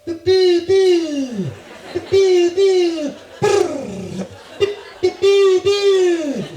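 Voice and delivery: very high, loud piercing bird hoots and trills; bird call. Retold or imitated. Comic mocking the dawn birdsong that wakes them, bird call. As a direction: imitate a screeching bird with rolled trills. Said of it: "waking up to some bird going".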